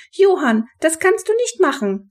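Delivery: very high, squeaking voice; squeaky protest. A narrator's reading. Mother scolding her brother Johann on the phone, squeaking. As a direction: let the voice squeak up in objection. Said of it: "Frau Schuberts Stimme begann zu quietschen".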